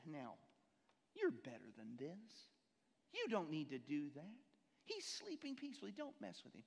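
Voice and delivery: a gentle little angel voice, lightly done; angel Jerry. Acted. Angel on Jerry's shoulder talking him down, gently. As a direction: coax him softly to be good. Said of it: "this little angel version of Jerry".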